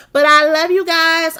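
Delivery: loud, high farewell; big loud goodbye to the chat; loud sign-off. Real, not acted. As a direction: beam it out to the viewers. Signing off to viewers.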